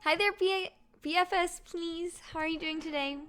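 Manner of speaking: high bright mock-polite hello; sing-song greeting. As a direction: greet them in a high chirpy voice. Greeting opponent in put-on voice.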